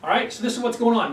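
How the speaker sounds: ordinary delivery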